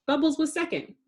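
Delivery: usual speaking voice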